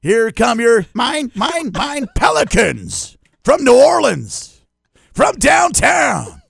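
high, loud, very slow arena call; announcer booming the Pelicans intro; mock lineup announcer. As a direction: drag it out like a hype announcer.

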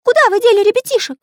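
fast, very high, loud demand; through clenched teeth. A narrator's reading. Little artist confronts pirates about the children, through clenched teeth. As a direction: demand it urgently in a piping voice. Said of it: "сжав зубы, процедил Карандаш".

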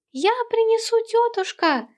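high eager call from the doorway; high child's call; boy calling out. A narrator's reading. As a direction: shout it back to auntie as you run. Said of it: "крикнул от двери малыш Сэм".

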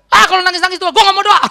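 put-on high, loud rebellious kid snapping back; defiant outburst. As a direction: shout it back at your crying mother. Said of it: "Ngelawan lagi".

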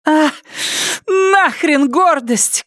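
high-pitched, drawn-out cry; Полли. A narrator's reading. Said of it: "крикнула Полли". (отчаянный высокий крик)

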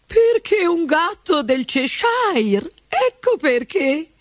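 high, slow, haughty; duchess voice. A narrator's reading. Duchess tells Alice about the cat, high and slow. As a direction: answer like an imperious duchess.

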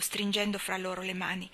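plain reading voice

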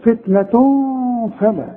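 فتنة read "fitnatun" with a long nasal hum into فلا; blurred, held nasal n before فلا; hidden noon, ghunna. Shown as a demonstration. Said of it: "يبقى هخفي وغن".

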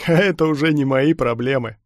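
loud, scornful dismissal; amused callousness. A narrator's reading. Stormtrooper dismisses the begging lad, callously amused. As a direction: brush him off with cruel amusement. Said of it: "хохотнул штурмовик".

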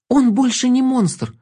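high, slow, insistent plea; pleading. A narrator's reading. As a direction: insist he's not a monster. Girl defends ghoul to wolf.

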